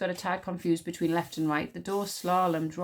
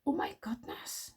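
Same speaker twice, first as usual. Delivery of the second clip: hushed, slow whispered exclamation; 4 semitones higher, 7 dB quieter, slower than usual. A narrator's reading. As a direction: whisper it under the breath, drawn out. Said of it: "whispered Wish".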